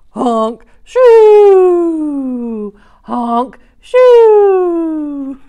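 big cartoon snore, honk then long shoo; loud snoring sounds. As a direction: ham up a loud dinosaur snore. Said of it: "those dinosaurs live far".

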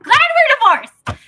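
mock-bright aside; sarcastic punchline. Real, not acted. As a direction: land it as a cheery kicker. Punchline after story.

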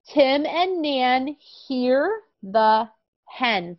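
very slow, clear reading; slow reading aloud. Shown as a demonstration. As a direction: model the sentence word by word. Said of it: "you read and Teacher Anya will listen".

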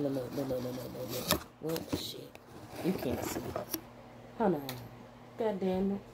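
usual speaking voice

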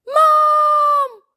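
very high, loud drawn-out scream for mum; panicked child's scream. A narrator's reading. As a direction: scream "Mam" in alarm, long and high. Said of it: "Gilt Job".

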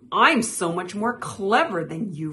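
loud, boastful jibe; taunting. A narrator's reading. Frog squabbling with Toad, mildly taunting. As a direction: taunt the rival lightly. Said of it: "Frog taunted".

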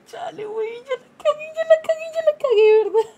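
high-pitched, rising to a loud near-crying wail; hammed-up realization she blew it; mock panic. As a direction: imitate her own panic, building to a whimper. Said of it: "Y yo dije".

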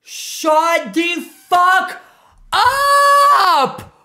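loud, slow, disbelieving yell; shocked shout. Real, not acted. Reacting to the mole reveal, loud slow shout. Shocked at game show result.

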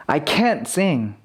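full, stressed CAN'T with falling tune. Shown as a demonstration. Teacher repeating negative example for learners, stressed can't. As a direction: hit 'can't', drop off on 'sing'. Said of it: "강세가 있으면 부정일 때가 많다".

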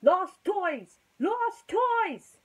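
high, loud announcer cry; announcing call. Acted. Mike the microphone calling out to the toys, high and loud. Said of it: "announced Mike the microphone".

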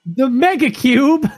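loud, high, drawn-out reveal; stretched-out, hyped-up proclamation; mock-dramatic announcement. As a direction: boom it out slowly like a big title.

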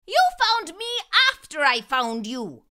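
put-on boy arguing with leprechaun, indignant; indignant protest. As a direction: push back at the trickster. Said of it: "Finnegan argued".

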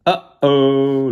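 mock dismay for young listeners; playful alarm. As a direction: react as if discovering trouble. Mock alarm at revealed picture.

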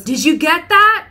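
loud, high shout into the speaker; driver yelling. Retold or imitated. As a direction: bellow it at the box. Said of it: "person who's driving goes".

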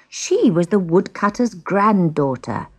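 plain reading voice

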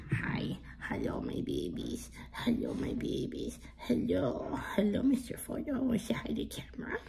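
gentle whispered pet greeting; soft whisper to pet. Real, not acted. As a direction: breathe it softly to the puppy. Whispering to her dog.

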